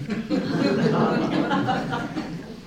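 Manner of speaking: a high, breathy giggle. A narrator's reading. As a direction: giggle at the snowdrift tumble. Laugh at snowdrift fall.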